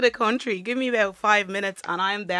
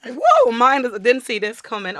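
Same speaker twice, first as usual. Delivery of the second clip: loud, higher startled reaction; mock astonishment; 6 semitones higher, 8 dB louder than usual. Onlookers hearing a Dublin accent, mock astonishment. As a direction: react as if caught off guard.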